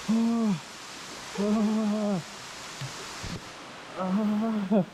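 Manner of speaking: high cold yelps; gasping shrieks in icy water; stamping feet for warmth. Real, not acted.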